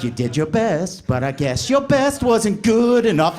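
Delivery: slow, smug big-brother gloat; mocking older brother taunting. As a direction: rub the loss in slowly. Big brother taunting while winning.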